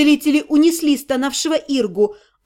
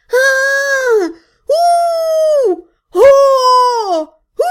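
high, loud wailing howl; wholehearted howl; 11 semitones higher, 6 dB louder, much slower than usual. Acted. Girl howling to show her friend, a high, loud howl. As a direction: howl with all your heart. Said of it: "завыла я от всего сердца".

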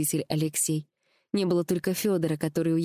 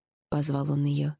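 usual speaking voice

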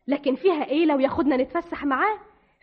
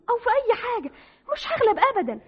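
higher, voice breaking into tears; tearful defiance; 7 semitones higher, faster than usual. Acted. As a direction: insist through the tears. Sobbing defiance. (تحدي بالدموع)

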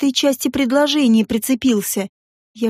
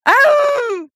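high drawn-out yelp; wounded howl; 13 semitones higher, 3 dB louder than usual. A narrator's reading. Woman grabbed and kissed, howling. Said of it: "взвыла я, как раненый федруар".

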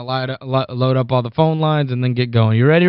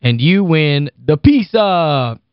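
ordinary talk, nothing marked

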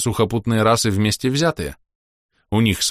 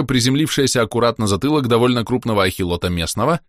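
plain reading voice

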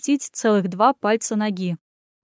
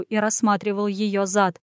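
usual speaking voice

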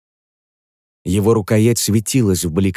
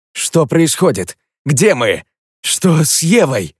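alarmed; 5 semitones higher, slower than usual. Acted. Panicked questions.

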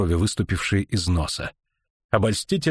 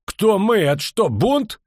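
loud and much higher, furious bark; barking, outraged; 11 semitones higher, 4 dB louder, slower than usual. A narrator's reading. Officer yells at the mutinous riders, outraged. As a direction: yell it like you can't believe the mutiny. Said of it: "рявкнул Дакрас Элефант".

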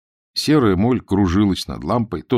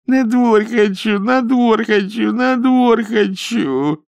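high, drawn-out capricious wail; whiny child; 14 semitones higher, 3 dB louder, slower than usual. A narrator's reading. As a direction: whine it over and over like a fretful child. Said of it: "капризным голосом, как ребёнок".

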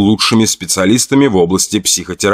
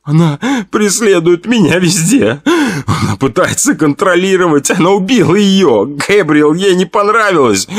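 high tearful voice; sobbing; 7 semitones higher than usual. Acted. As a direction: cry it out in pieces. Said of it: "Сквозь всхлипы".

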